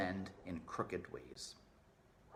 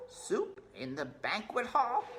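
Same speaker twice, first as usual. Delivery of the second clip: hopeful rat voice; 13 semitones higher than usual. Acted.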